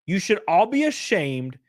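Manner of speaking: ordinary talk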